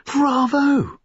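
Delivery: high, delighted cry of bravo; excited girlish bravo; cried out. A narrator's reading. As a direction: burst out with glee, clapping. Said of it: "cried one of the others, clapping her hands".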